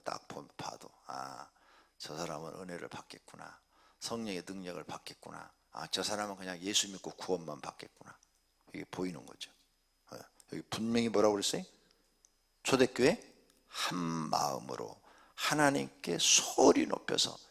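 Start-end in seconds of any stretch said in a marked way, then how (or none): 1.1-8.2 soft, low inner judgement; musing to oneself. Acted. Preacher privately judging who will receive grace, quiet musing. 13.7-17.4 slow, low, stretched phrases; slow emphatic quoting. Shown as a demonstration. Preacher quoting the early church verse, slowly and emphatically.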